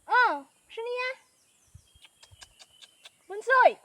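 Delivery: high, loud call to the animals. Real, not acted. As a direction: call them from across the field. Calling out to the animals. (malakas na pagtawag sa mga hayop)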